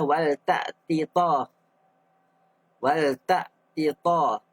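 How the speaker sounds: slow, each letter of "Wal ta'ti ta" sounded apart, the ta not merged; clear izhar reading. Shown as a demonstration. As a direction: say "Wal ta'ti ta" slowly with a distinct ta. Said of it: "kita baca jelaslah". (sebut "Wal ta'ti ta" perlahan, huruf ta dijelaskan)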